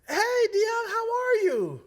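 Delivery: imitating Miss Calhoun. Miss Calhoun greeting Dion on the phone, high cheery imitation. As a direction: chirp a sunny phone greeting. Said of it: "Miss Calhoun calls".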